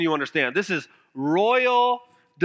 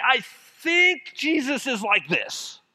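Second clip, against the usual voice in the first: slow, lightly put-on voice of the crowd; a hint of a know-it-all crowd member; mock crowd voice; 5 semitones higher, slower than usual. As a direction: gently mimic a smug onlooker. Mimics the crowds' shallow view.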